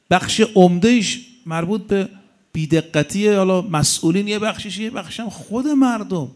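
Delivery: usual speaking voice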